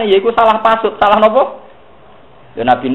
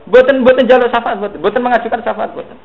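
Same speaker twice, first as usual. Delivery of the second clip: emphatic denial; 9 semitones higher than usual. Real, not acted.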